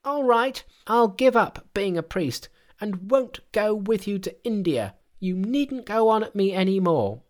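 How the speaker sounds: high-pitched, petulant monkey voice; high, indignant outburst; flared-up Monkey. A narrator's reading. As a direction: pitch it right up in a huff. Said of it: "he flared up at once and cried".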